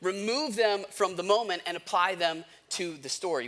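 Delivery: normal talking voice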